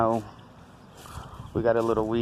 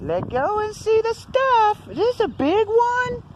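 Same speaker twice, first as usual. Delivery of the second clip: high playful voice; 5 dB louder than usual. Pretend. High kid-like voice.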